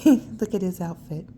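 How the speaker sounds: usual speaking voice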